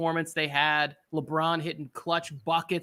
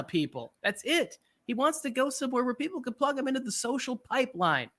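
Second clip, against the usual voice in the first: mock voice; 7 semitones higher than usual. Mocking CP3's motives, raised pitch.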